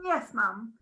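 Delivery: ordinary talk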